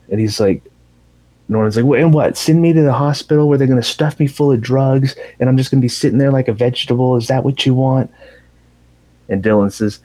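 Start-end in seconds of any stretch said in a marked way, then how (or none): 1.8-8.1 higher, fast, rattled-off retort; defiant challenge. Retold or imitated. Norman challenges his brother over hospital, mock-defiant. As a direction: imitate an indignant comeback. Acted quarrel between the brothers.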